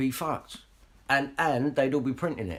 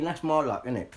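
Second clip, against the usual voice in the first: normal talking voice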